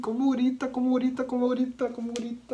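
high-pitched, repeating in distress; overwhelmed by the scream, voice up high; real horrified shock. Real, not acted. Rattled by the scream in clip.

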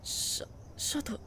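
soft, slow, stammered name; hesitant. Acted. Girl hesitates to correct Shoto about the stars, soft stammer. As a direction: falter quietly before breaking bad news.

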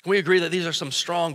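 ordinary talk, nothing marked

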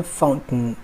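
"फाउंटेन" as FOWN'n, almost no second vowel; actual native pronunciation. Shown as a demonstration. Teacher showing the actual form, "फाउंटेन" as fount'n. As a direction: swallow the end of "फाउंटेन" into a syllabic n. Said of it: "actual pronunciation की बात करें".

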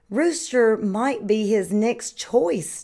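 usual speaking voice

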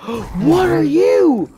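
high, loud and slow, drawn out in shock; horrified drawn-out cry; stunned shout. Real, not acted. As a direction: gape at the monster and stretch the words. New monster appears.

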